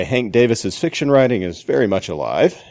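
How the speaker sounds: ordinary talk, nothing marked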